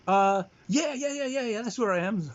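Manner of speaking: high, eager mock-dad reply; oblivious Dad. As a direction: rattle off the yeses as an oblivious caller. Dad cheerfully confirms his dangerous location.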